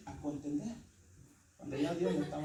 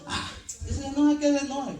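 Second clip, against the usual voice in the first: put-on high, louder sulky kid voice; childish huff; 9 semitones higher, 9 dB louder than usual. Spiritually childish brother shrugging off an upset one, high and sulky. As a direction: pout like a spiteful child. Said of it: "como es un niño".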